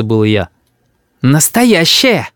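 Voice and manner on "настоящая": put-on drawn-out, higher childish assurance; insistent assurance. A narrator's reading. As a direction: insist slowly, like a sure little boy. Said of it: "заверил Рис".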